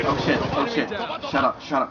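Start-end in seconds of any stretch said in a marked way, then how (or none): none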